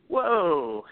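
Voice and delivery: mock startled reaction; startled whoa. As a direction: retell a taken-aback recoil. Retold startled recoil at door.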